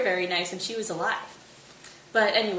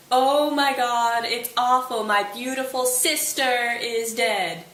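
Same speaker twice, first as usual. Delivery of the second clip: hammed-up wail, louder and slow; loud wailing grief; 3 semitones higher, 5 dB louder, slower than usual. Laertes at the grave wailing over his sister, loud and slow. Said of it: "probably really loudly".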